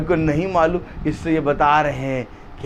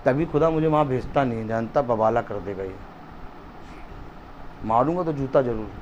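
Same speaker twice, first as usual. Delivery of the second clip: usual speaking voice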